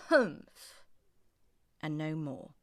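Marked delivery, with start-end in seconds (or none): 0.1-0.8 high, snorting camel grunt; a haughty nasal-free camel snort; scornful camel huff; camel's humph. Acted.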